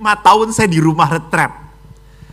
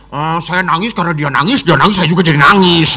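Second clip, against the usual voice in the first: put-on 1 child's voice; faster than usual. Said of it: "gitu ya".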